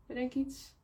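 normal talking voice

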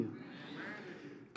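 normal talking voice

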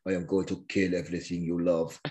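a hint of a John Wick-style avenger; mock movie villain. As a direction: growl it like an action-film villain. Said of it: "I don't know what accent that was".

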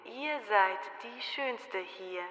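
leise Spiegelstimme; Spiegel antwortet. A narrator's reading. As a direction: leise und geheimnisvoll verkünden. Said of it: "Da antwortete der Spiegel". (leise Spiegelstimme)

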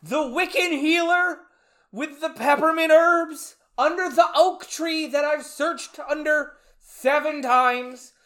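high, loud, hammed-up indignation; mock outrage. Podcaster imitates Ben scolding townspeople over Sarah, mock-outraged. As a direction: wail the complaint like a wronged novelist. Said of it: "so Ben's very disappointed".

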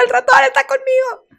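put-on a girl trapped with a mouse, panicking; panicked shout. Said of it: "Y yo".

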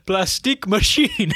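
lightly mimicking a foreign accent, plastique with a long ee; mock foreign accent on Plastique machine, plas-TEEK; a hint of a foreign accent on Plastique, said plas-TEEK. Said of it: "he says it in an accent".

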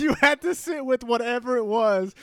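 high, loud words through laughter; laughing. Real, not acted. Friend recalls the spill to Rafa, laughing. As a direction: push the words out while cracking up.

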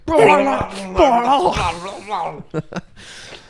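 loud, high garbled bellowing; mock roaring. Imitating an actor carrying on in the background, mock roaring. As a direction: roar wordlessly like a man going wild behind you. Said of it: "just in the background, like".

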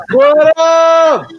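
high, loud hype hello; shouting hello to the whole call; loud excited greeting. Real, not acted. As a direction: burst in with big energy. Guest bursts onto the call.

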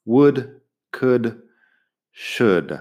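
Would, could, should with the L silent. Shown as a demonstration. Teacher modelling for learners, L left silent. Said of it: "La L, nada".

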